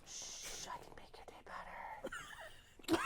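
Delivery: breathy hushed come-on, fingers on her lips; seductive whisper. Acted. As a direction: whisper it close and teasing. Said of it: "and he goes".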